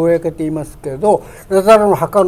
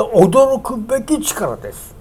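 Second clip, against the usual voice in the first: ordinary delivery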